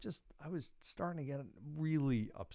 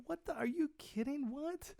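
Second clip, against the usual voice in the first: stunned disbelief. Retold or imitated. Disbelief as valets laugh.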